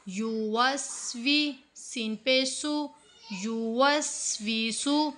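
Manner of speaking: युवस्विसु spelled out slowly, सु kept short; syllable by syllable. Shown as a demonstration. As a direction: build युवस्विसु slowly, don't stretch सीन. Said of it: "सीन को नहीं खींचना". (हिज्जे करके धीरे धीरे)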